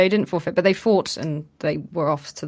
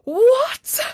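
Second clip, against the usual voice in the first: high, drawn-out acted shriek of shock; hammed-up astonished outcry; losing our minds; 14 semitones higher, 4 dB louder, much slower than usual. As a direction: imitate a crowd in disbelief. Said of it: "losing our minds".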